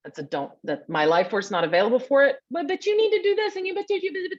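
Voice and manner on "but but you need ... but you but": high-pitched rapid chatter of the mind; the nagging mind. Acted. Mind cuts in on the gut's no, high insistent chatter. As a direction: pipe up high, tripping over your own buts. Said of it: "but, that mind".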